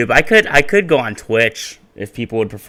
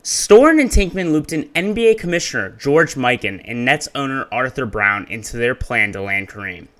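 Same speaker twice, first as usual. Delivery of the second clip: higher, upbeat video narration; YouTuber voice; 3 semitones higher, 4 dB louder than usual. Shown as a demonstration. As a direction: read it like an energetic YouTube narrator. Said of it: "Let's get YouTuber voice going".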